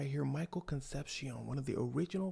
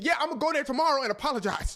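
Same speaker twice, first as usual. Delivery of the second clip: quick, high, loud capitulation; panicked agreement; 12 semitones higher, 9 dB louder, faster than usual. Acted. Michael agreeing to apologise to Hammer, flustered. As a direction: blurt it out, eager to make amends.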